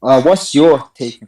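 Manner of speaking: slow loud demand. Real, not acted. Caller pressing host.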